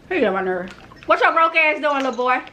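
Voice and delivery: usual speaking voice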